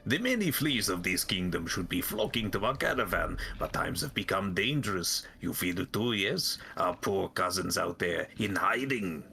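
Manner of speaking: put-on lilting flea leader, Russian-French accent. Flea master tells of cousins in hiding, French-Russian accent. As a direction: lament lost kin in a muddled French-Russian accent. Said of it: "French Russian, both at once".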